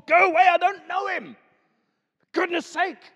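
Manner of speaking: high, loud frightened protest; panicked denial. Acted. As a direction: shout it off as a scared Peter. Peter swearing he doesn't know him.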